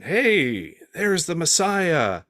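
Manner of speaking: high, drawn-out mock call; drawn-out pretend greeting; mock onlooker. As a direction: hail him like a passer-by. Acted crowd spotting Jesus.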